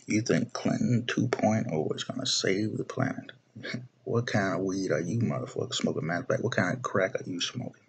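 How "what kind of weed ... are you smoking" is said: normal talking voice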